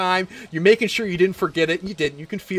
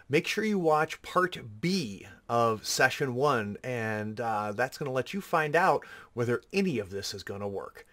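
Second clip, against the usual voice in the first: outro announcement; 4 semitones lower, 7 dB quieter than usual. Acted. Outro read, quieter.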